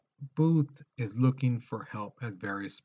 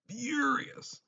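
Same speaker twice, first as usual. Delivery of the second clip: furious repeated much higher and drawn out; furious flung up into a high squeak; echoed word jumping high. Shown as a demonstration. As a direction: say furious again, way up high for emphasis. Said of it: "Booth is just furious".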